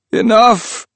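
high, loud desperate shout; shouted cry. Acted. Priest shouting at the bleeding hand to stop.

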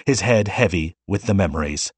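usual speaking voice

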